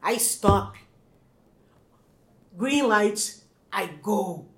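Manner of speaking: put-on halting Brazilian-accented English, word by word; heavy Brazilian accent. Immigrant gives officer her statement, halting Brazilian accent. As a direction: labour out each phrase in Brazilian English. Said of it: "Eu tive que falar desse jeito".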